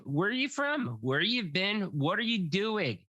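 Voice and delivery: usual speaking voice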